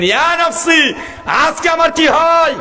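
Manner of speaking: high, drawn-out wail of dread; acted anguished cry. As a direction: wail it high and long, as a preacher would. Acted prophet fearing for himself.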